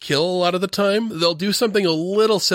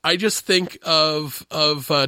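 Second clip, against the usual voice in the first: usual speaking voice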